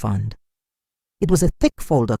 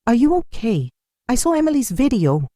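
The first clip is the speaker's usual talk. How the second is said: curious; 8 semitones higher than usual. A narrator's reading. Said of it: "Her voice was full of curiosity".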